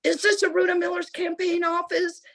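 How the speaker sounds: acting out an angry caller in a high, tense pitch; mock-angry demand, pitched well up; irate caller. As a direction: play the irate man on the phone. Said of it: "the person is irate and angry".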